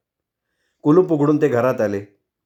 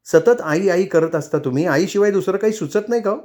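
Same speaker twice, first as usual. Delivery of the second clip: higher, exasperated complaint; wife snapping back in irritation; irritated outburst; 7 semitones higher than usual. Acted. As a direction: let the irritation spill over. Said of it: "ती चिडून बोलली".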